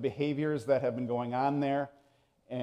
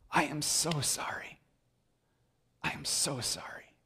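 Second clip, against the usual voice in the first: soft unvoiced apology; whisper; 9 dB quieter than usual. Pastor voicing a sinner's private apology, whispered. Voicing repentant inner thought.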